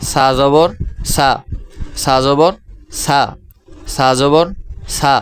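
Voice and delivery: সা জবর সা chanted as a drill; teaching pronunciation. Shown as a demonstration. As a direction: model সা for the class. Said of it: "উচ্চারণ করছি".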